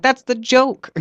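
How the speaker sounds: high, bright-pitched comeback; retorting. Retold or imitated. Comedian retells his quick comeback, pitched high. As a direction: snap back like it's obvious. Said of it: "I'm like".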